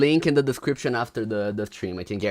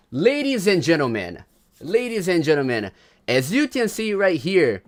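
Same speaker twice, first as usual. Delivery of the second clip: drawn-out showman's announcement, building louder; grand announcer; 4 semitones higher, 4 dB louder than usual. Acted. Streamer unveiling the ranking to viewers, grand announcer. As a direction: announce it like a big event, phrase by phrase.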